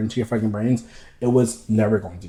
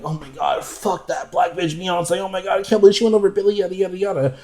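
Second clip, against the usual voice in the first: much higher, outraged mock rant; mock angry hater; 8 semitones higher than usual. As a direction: ham up a bitter fan whining. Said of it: "call for you to be like".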